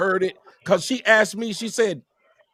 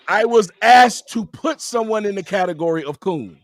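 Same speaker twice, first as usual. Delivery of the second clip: usual speaking voice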